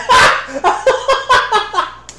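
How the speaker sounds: high-pitched hysterical cackle; hysterical laughter. Acted. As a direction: laugh uncontrollably, rolling about. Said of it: "The cheetah starts laughing hysterically".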